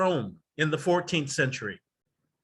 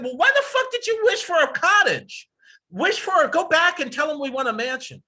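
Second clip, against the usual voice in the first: high, loud, indignant wife voice; a hammed-up greedy wife scolding her husband; 10 semitones higher, 4 dB louder than usual. Greedy wife voiced in retold tale.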